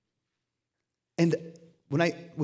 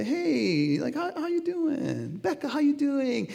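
high sing-song hello, drawn out; put-on high-pitched "how are you" voice; mock friendly greeting; 11 semitones higher, slower than usual. Acted chatty greeting to friend.

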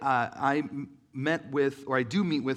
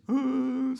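a sudden loud intake of breath, higher; sharp gasp; 10 semitones higher, 6 dB louder than usual. Retold or imitated. Telling a crowd of being overwhelmed, sharp gasp. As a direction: gasp as if struck by the moment.

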